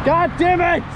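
high, loud frustrated curse; furious shout. Real, not acted. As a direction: yell it out in real exasperation. Cursing at traffic in frustration.

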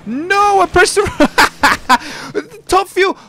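sudden high, loud cry of surprise, then slow; shocked yelp at his own mistake; startled yell. Real, not acted. Gamer startled after pressing the wrong button, high yelp.

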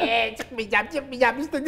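high-pitched bratty little brother; küçük kardeş. Acted. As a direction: refuse like a whining cartoon kid. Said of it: "küçük kardeş odaya giriyor". (yaramaz küçük kardeş sesi)

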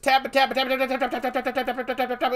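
high, rapid-fire repetition like tap shoes; frantic fast tapping. Retold or imitated. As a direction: rattle the word out on a high pitch. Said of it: "He tapped so fast".

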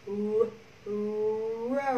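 "R" held with rounded lips, then "rope"; tongue-back r. Shown as a demonstration. Teacher demonstrating mouth shape to class, long rounded r in "rope". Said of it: "lưỡi các bạn giật lại đằng sau".

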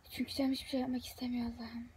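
whispered weary complaint. Real, not acted. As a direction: whisper it, drained. Bitkin sızlanma. (bitkin fısıltı)

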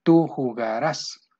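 slow "jugarás" with the final ás accented; stress on last syllable. Shown as a demonstration. Teacher showing the Spanish accent rule, last syllable stressed in "jugarás". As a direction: stretch it out and land on the end of "jugarás". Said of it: "lleva acento en la última".

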